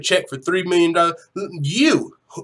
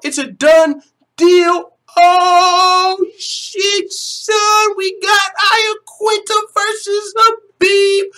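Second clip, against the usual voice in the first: high, loud, sustained hype yell; hyped shouted announcement; 12 semitones higher, 6 dB louder than usual. Real, not acted. Fan announcing Khabib versus Iaquinta to viewers, hyped shouting.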